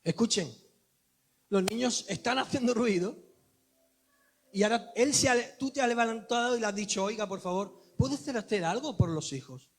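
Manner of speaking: loud, high sermon build-up; full-force pulpit voice; fiery preaching. Acted. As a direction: drive the story home to the congregation.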